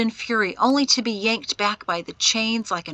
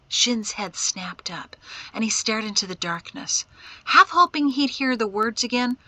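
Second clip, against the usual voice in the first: ordinary delivery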